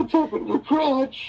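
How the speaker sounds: high mocking whine; whiny tantrum. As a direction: grumble like a sulky kid. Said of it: "a temper tantrum".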